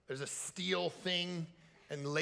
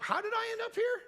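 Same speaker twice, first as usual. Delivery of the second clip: dismayed disbelief. Retold or imitated. His own dismay in the car.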